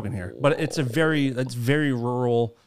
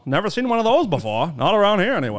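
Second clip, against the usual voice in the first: higher, louder suspicious Maine local; Maine accent; 8 semitones higher, 6 dB louder than usual. Shown as a demonstration. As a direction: eye a stranger warily in a broad Maine accent. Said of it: "that's Maine for you".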